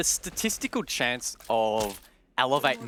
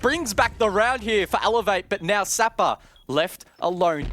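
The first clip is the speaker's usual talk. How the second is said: loud, high play-by-play shout as DCH goes huge; excited shout; 11 semitones higher, 6 dB louder than usual. Real, not acted. Caster yelling to viewers over a huge clutch. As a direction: yell it out as the clutch lands.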